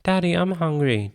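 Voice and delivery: put-on high little boy voice; child announcing. A narrator's reading. Son interrupting dad's work to say he's hungry. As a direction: announce it like a small kid. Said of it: "David announced".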